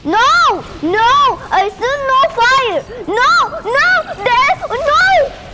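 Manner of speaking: loud, high frantic no; frantic gamer yelling at the screen; panicked no's. Real, not acted. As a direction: shout no as the laser hits.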